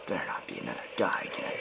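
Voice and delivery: quick, hushed worry; fast whisper. Real, not acted. Player dreading another enemy, fast anxious whisper. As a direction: rush it out in a whisper. Muttering worry during game.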